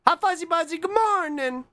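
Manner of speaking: very high sing-song hello; cutesy greeting. Pretend. Streamer saying good morning to Fuzzy Buzzy, cutesy high voice. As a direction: greet them in a tiny high voice.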